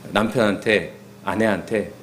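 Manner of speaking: ordinary talk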